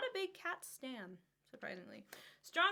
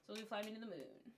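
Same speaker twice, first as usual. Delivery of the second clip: normal talking voice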